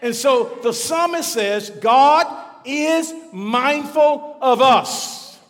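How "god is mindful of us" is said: loud, slow and higher, each word weighted; slow proclamation. Acted. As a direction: proclaim it slowly to the congregation. Preacher proclaims the verse.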